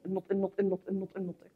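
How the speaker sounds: very low, soft muttering; muttered repetition. Real, not acted. Teacher nagging about pronunciation, muttered under her breath. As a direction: repeat it under your breath, trailing away.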